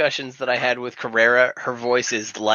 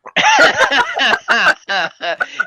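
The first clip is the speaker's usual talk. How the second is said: loud high laugh; high burst of laughter; 10 semitones higher, 5 dB louder than usual. Real, not acted. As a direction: break out laughing. Laughing at the joke.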